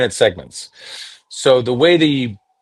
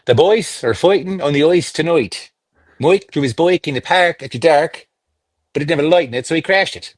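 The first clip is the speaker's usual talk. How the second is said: higher-pitched Prince Edward Island vowels; Prince Edward Island accent; 5 semitones higher than usual. Shown as a demonstration. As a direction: islander telling a tale in PEI accent. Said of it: "the O Y S is like an I C E".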